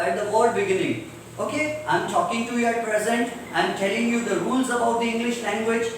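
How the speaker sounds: ordinary delivery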